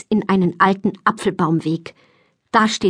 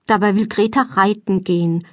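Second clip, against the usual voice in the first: plain reading voice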